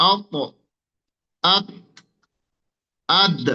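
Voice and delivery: অপ, আত, আদ with each last letter stopped dead; sakin demo. Shown as a demonstration. Teacher demonstrating sukun letters to students. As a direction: say আদ ending hard on the tongue tip. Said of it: "সাকিন করে উচ্চারণ করুন".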